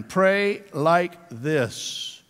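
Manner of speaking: drawn-out, word by word; slow and deliberate. Shown as a demonstration. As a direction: spell out the instruction very slowly. Repeats the key verse slowly.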